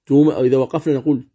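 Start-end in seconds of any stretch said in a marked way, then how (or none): none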